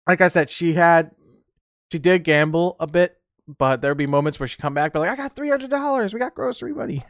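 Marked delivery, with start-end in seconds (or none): 5.1-7.0 grandma bragging. Retold or imitated. Grandma back from gambling.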